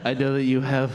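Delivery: low, halting, earnest wooing; bashful suitor searching for words; awkward lovestruck student. Acted. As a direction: offer each dish shyly, with long pauses.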